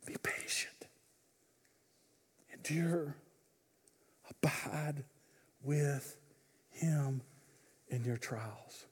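hushed, breathy whisper of each word; whispered urging. Real, not acted. Preacher gently urging the congregation to endure, whispered. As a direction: whisper it slowly as a blessing.

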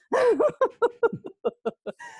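a high, bursting laugh at herself; bright rising giggle; real laugh. Real, not acted. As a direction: laugh out loud at the image of chatting with a chair. Laughs at talking to chairs.

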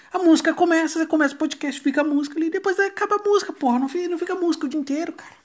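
loud, high, fast exasperated rant; frustrated rant. Real, not acted. Irritado com o aplicativo. (desabafo irritado e alto)

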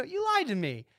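imitating his disappointed self, high and wounded; bummed accusation. Host retelling letting down a would-be creator, mock hurt. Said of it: "I'm so bummed".

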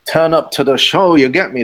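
normal talking voice

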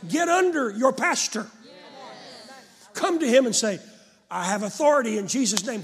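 loud, rising pulpit voice that drives every line home; fiery preacher's call to use authority; preaching at full force. Acted. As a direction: preach it hard to the congregation. Preacher exhorts the congregation.

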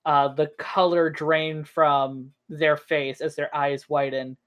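ordinary delivery

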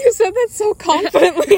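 laughing. Real, not acted. Teasing friend's confident claim.

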